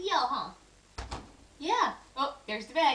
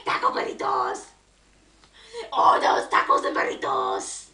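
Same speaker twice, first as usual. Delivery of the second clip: rough, voiceless rasp for fun; silly raspy voice. Pretend. Parent amusing a baby with tacos and burritos, silly raspy voice. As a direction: playfully rasp it out without full voice.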